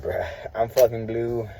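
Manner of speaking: ordinary talk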